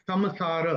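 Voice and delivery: "समसार" with a clear m before s; rule-based anusvara pronunciation. Shown as a demonstration. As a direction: say "समसार" as sam-saar, lips closed on the m. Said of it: "rule के हिसाब से उसका उच्चारण होगा".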